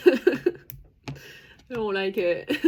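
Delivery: put-on slow, childlike whine through a laugh; like a child. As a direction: whine it out like a little kid, half laughing. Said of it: "Like a child".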